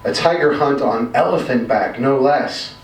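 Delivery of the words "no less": usual speaking voice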